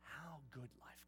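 ominous whisper; hushed warning. Pretend. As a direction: breathe the warning.

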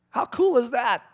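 usual speaking voice

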